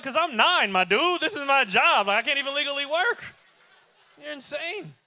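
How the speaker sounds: put-on loud, high outraged kid; indignant protest. Nine-year-old told to earn his keep, outraged.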